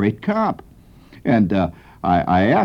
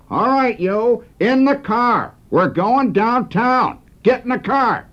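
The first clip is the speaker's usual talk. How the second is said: much higher barked cop orders, slow on going downtown; mock tough cop; 13 semitones higher, slower than usual. Kid rehearsing police orders in the backyard, mock tough cop. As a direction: play the rookie cop ordering a suspect into the car.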